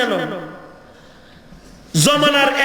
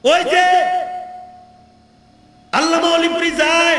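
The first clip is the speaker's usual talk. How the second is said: high, drawn-out calling out; imitated crowd call; 3 semitones higher, slower than usual. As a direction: mimic onlookers hailing a passer-by. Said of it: "কিছু লোকে চিৎকার করে ডাক দিছে". (চিৎকার করে ডাকার নকল)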